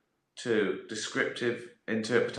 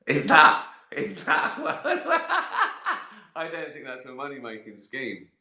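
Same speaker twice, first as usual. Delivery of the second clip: higher, slow and laughing through the words; 3 semitones higher, 4 dB louder, slower than usual. Real, not acted. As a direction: giggle while you talk it down. Laughing at his own idea.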